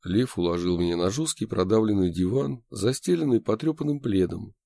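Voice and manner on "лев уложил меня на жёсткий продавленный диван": plain reading voice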